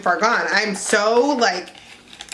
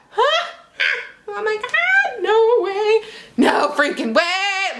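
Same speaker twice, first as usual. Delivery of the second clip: excited disbelief; 10 semitones higher, slower than usual. Real, not acted. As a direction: squeal in disbelief. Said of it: "Freaking out".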